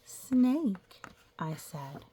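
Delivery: slow, drawn-out storybook reading; reading to children. Acted. Teacher reading a picture book to the class, storybook voice. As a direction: read it like a picture book for little kids.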